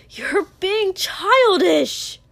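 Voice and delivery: loud, high, drawn-out angry yell; vicious shout. A narrator's reading. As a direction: stretch it into a furious high shout. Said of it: "Izuku added viciously".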